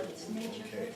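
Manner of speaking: very soft, withdrawn reply; shut down. Retold or imitated. Dad faced with too much excitement, shutting down. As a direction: imitate someone retreating, voice dropped right away. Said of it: "Get too excited, my dad would be like".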